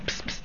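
soft unvoiced psst; whispered psst. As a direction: hiss a secret psst. Yid whispers his plan to judge.

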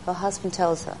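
ordinary delivery

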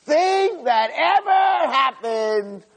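a haughty servant showing off to his master's wife; arrogant boasting. Retold or imitated. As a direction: puff yourself up and crow to her. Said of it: "He's telling Potiphar's wife".

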